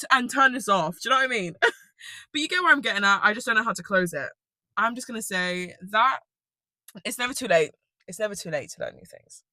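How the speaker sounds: ordinary talk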